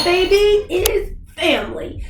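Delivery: high, bright hype; excited. Real, not acted. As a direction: sell it with a big lift. Hyped channel welcome.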